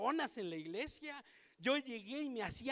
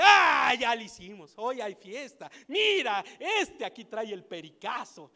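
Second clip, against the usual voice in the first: loud, pitched-up gleeful quote; mock excited thieves; 11 dB louder than usual. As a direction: act out the gleeful thugs. Thieves celebrating finding the bag.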